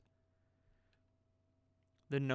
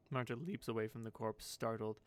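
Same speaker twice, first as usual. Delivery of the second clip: usual speaking voice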